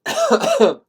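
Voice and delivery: short burst of high laughter; sudden bright squeal of a laugh. Real, not acted. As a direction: laugh at your own made-up term.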